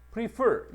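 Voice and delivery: prefer를 높고 크게, 뒤 음절에 힘; prefer 뒤 강세. Shown as a demonstration. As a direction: prefer 뒤 음절을 힘차게 찍어서. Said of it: "강세를 좀 잘 봐야 돼". (prefer 뒤 음절 강세를 높고 크게)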